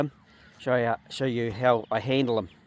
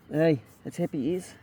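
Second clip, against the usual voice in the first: softer, gentle praise murmured to the animal; soft coo to the ram; 7 dB quieter than usual. Real, not acted. Praising the ram's happy ears, softly. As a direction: soothe the ram quietly.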